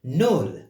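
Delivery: "Null" said loud and clear as nol; teacher models Null for the class to repeat; model word for repeating. Shown as a demonstration. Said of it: "Can you repeat that?".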